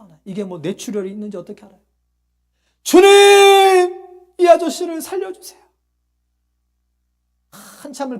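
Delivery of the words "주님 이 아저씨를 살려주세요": loud, high-pitched pleading shout; 소리 지르는 기도. Retold or imitated. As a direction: scream to God to save him. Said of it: "소리 질러 기도했는지 몰라요". (목청껏 부르짖는 절박한 기도)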